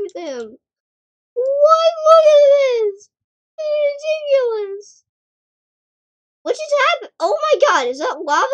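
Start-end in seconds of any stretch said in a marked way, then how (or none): 1.3-5.0 startled shout. Real, not acted. Shocked at something on screen.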